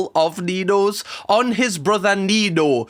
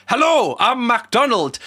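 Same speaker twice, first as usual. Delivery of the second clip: mock pushy greeter; 6 semitones higher, slower than usual. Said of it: "somebody keeps coming up to you going".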